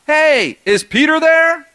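high, drawn-out holler; acted call, pitched well up. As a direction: call for someone as if from outside. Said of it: "they call out".

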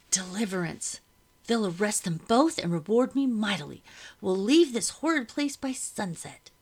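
rising, excited inner exclamation; jubilant hope. A narrator's reading. Servant silently anticipating reward and escape, jubilant. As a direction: build from hushed relief to eager triumph. Narrator's jubilant thoughts of rescue.

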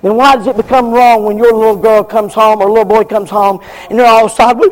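usual speaking voice

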